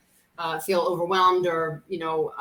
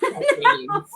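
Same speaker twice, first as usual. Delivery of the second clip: laughing; 6 dB louder than usual. Real, not acted. Laughing about decades of friendship.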